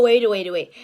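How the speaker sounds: ordinary delivery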